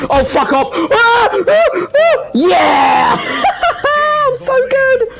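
very high victory shrieks with laughter; ecstatic screaming. Real, not acted. Gamer winning after a tense finish, ecstatic screaming. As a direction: erupt in wild, loud yells of triumph.

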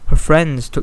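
ordinary delivery

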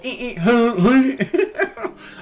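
high honks breaking into laughter; imitated duelling horns. Both vehicles honking together, laughing horn imitation. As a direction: mimic car and truck horns at once. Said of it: "a little Civic and a semi just honking".

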